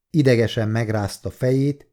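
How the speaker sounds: usual speaking voice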